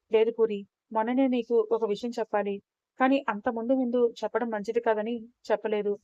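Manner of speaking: ordinary delivery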